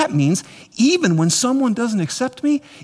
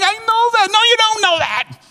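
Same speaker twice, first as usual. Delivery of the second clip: acted insistent back-and-forth; 14 semitones higher than usual. Acted back-and-forth argument with God.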